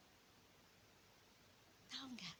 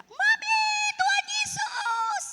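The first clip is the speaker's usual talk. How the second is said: high, loud, drawn-out scream for Mami; child's cry for help; 11 dB louder, slower than usual. Retold or imitated. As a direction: cry out to Jesus like a frightened child at night. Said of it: "tiba-tiba dia teriak". (teriakan anak yang keras dan panjang)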